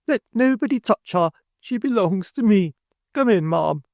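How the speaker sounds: high, proprietary order; possessive command. A narrator's reading. Mrs Sparsit claiming the old woman, high possessive command. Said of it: "cried Missus Sparsit with great energy".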